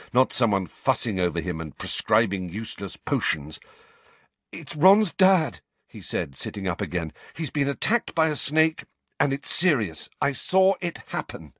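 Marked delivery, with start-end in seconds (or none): 4.5-5.6 high, loud, slow and emphatic; urgent alarm. Acted. Harry urgently tells the professor. 7.3-11.5 higher, insistent report; urgent alarm. Acted. Harry urgently reports to McGonagall.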